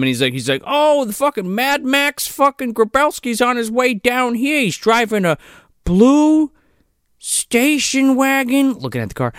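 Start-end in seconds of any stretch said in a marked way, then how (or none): 0.7-8.7 higher-pitched put-on shopkeeper voice; shop owner impression. Shop owner interrupting to warn of a fugitive, put-on urgent voice. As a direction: announce the news like an alarmed local. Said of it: "the fucking shop owner runs out and interrupts him".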